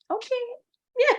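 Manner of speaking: squeaky high, drawn-out "Okay"; swooning okay. Retold or imitated. Fiancée calls to say she misses her, swooning high okay. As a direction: melt into a high little okay. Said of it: "And I'm like".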